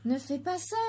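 ordinary delivery

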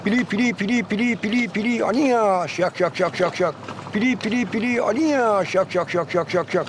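quick lilting pili run, drawn turn, steady beats; rare bird's song. Shown as a demonstration. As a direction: imitate a finch's flowing phrase. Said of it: "Pili pili hiç duymadığım bir kuş". (pili pili kuş ötüşü taklidi)

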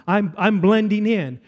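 usual speaking voice

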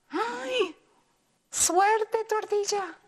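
high, eager good-luck cry; excited cheer. Acted. Teacher cheering the tortilla, high and excited. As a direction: cheer her on.

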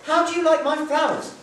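high, loud eager question; proud little girl. Acted. Gretel asking Hansel about her garland, eager. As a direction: show off the garland. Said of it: "she asked Hansel".

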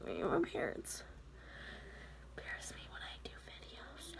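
whispered embarrassed aside to viewers. Real, not acted. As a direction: secret aside, breathy whisper.

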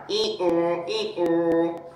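low playful "ee-oh" bray; donkey hee-haw. Shown as a demonstration. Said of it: "Can you do those donkey noises again?".

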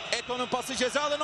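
usual speaking voice

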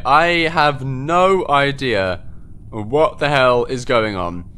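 ordinary talk, nothing marked

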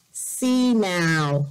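ordinary talk, nothing marked

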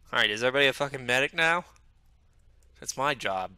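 exasperated. Acted. Medic jealous of his job, exasperated retort. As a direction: fire it off, annoyed and loud.

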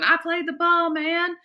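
high, desperate yell; screaming protest. A narrator's reading. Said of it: "jumped up screaming".

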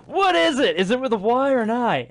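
high, loud startled question; sudden high incredulous what; shocked. Real, not acted. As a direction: blurt it out in disbelief. Reacting to Siri mishearing.

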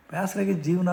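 retold exasperated cry; fed-up outburst. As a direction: blurt out the frustration with life. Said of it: "ಅಂದ್ರು ಅವರು".

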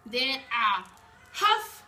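slow, loud wolf threat. Acted. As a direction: huff out each word slowly.

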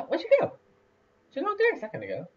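startled real search, voice jumping up; surprised. Real, not acted. Dog suddenly gone from view.

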